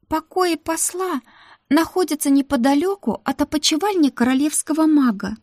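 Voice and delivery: put-on high eager young maid; Ruta's voice. Ruta vouching to the madam for the new maid, high and eager. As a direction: pitch it up, pleading for the new girl.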